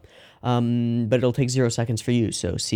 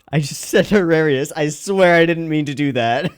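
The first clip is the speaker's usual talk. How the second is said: laughing; 6 semitones higher, 6 dB louder than usual. Real, not acted. Laughs at own slip.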